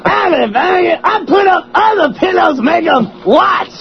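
high, hammed-up stand-up shouting; Chris Rock. Host confessing in the comic's style, Chris Rock impression. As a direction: rant in a comedian's high holler. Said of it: "That's Chris Rock".

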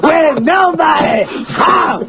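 high, slow comic holler; Chris Rock. Acted. Host bragging about being home alone, Chris Rock impression. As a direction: stretch each phrase like a shouting stand-up. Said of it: "That's Chris Rock".

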